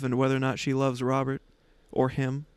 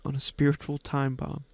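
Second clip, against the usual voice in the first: usual speaking voice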